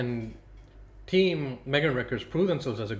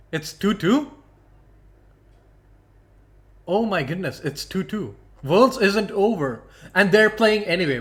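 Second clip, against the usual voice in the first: loud, higher-pitched disbelief; real astonishment; 7 semitones higher, 9 dB louder than usual. Real, not acted. Caster tells viewers Worlds is tied, loud and astonished. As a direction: burst out amazed at breaking news.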